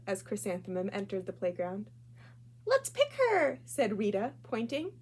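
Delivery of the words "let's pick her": put-on much higher, louder taunting kid; mocking girl voice. Classmate Rita taunting Chrysanthemum on the playground, high and loud. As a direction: taunt her in a squeaky playground voice.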